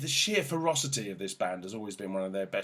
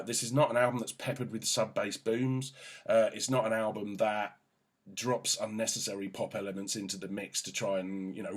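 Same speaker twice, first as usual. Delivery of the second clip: usual speaking voice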